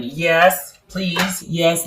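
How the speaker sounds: ordinary talk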